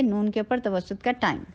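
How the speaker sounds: normal talking voice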